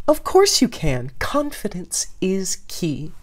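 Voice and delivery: high then whispered, too sweet; overly reassuring. Acted. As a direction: reassure a bit too hard. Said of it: "sounded a little too reassuring".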